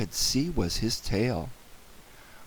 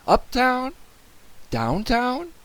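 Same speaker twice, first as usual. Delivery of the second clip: high-pitched, searching guesses; anxious questioning; 12 semitones higher, slower than usual. A narrator's reading. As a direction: offer each place as a worried question.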